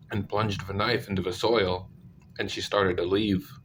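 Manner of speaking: ordinary delivery, nothing marked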